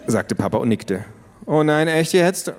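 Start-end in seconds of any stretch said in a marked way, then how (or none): 1.4-2.5 eye-rolling groan. A narrator's reading. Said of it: "rollte mit ihren Augen".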